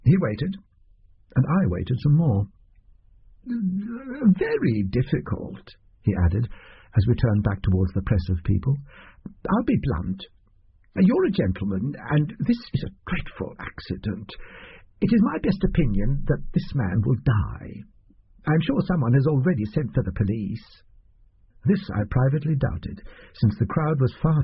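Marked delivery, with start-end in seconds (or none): 3.5-5.8 slow and much higher, milking it; drawn-out sigh. Acted. Doctor draws out his point. 9.5-20.8 fussy little doctor. Acted. Doctor's voice held.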